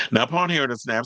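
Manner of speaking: ordinary talk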